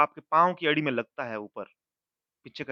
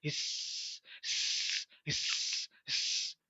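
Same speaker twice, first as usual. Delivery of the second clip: whispered, soft snake hiss; teacher hissing like a snake; imitating a snake hiss; 2 semitones higher, 7 dB quieter, much slower than usual. Shown as a demonstration. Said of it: "उसकी sound कैसे निकलती है?".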